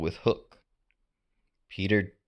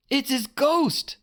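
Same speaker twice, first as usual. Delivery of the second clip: very high, slow frightened cry; a spooked sailor squeals; scared little pirate; Smee crying out; 3 dB louder than usual. A narrator's reading. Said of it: "Smee cried".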